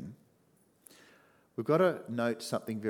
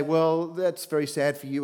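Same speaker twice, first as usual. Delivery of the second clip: uncaring; 5 semitones higher, 7 dB louder than usual. Pretend. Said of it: "like he doesn't really care".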